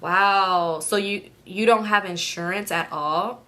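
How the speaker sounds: slow, composed disbelief; cool, measured questioning, all wailing gone; tears dried up. Retold or imitated. Other driver learning there's no insurance, cool disbelief. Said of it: "tears kinda dried up".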